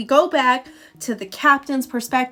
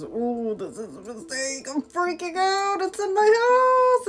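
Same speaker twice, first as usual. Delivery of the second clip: freaking out; 8 semitones higher than usual. Retold or imitated. Scientist realizes his mistake, panicking higher and higher. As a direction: panic rising to a high squeal. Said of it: "he realizes".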